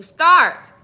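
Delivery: loud, sharp command of a single word; hollering. Real, not acted. Hollering at a viewer to start now. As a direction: bark it out to get them moving. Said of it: "Yes, I'm hollering at you".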